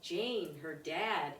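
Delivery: ordinary delivery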